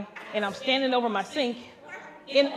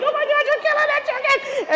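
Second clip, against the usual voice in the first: high loud alarmed cry; shrieking in alarm; horrified shriek; 8 dB louder than usual. Retold or imitated. Horrified exclamation.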